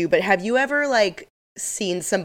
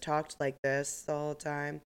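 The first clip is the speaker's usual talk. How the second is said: low-key, slow, much softer monotone; 3 semitones lower, 10 dB quieter than usual. Retold or imitated. Imitating a strange coworker's talk, monotone. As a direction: drone it slowly and quietly. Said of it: "Very monotone".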